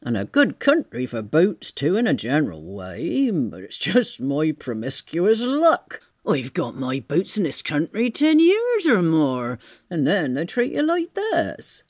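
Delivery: grumbling tramp. Acted. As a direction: grumble like a put-out vagrant. Voices Marvel's complaint.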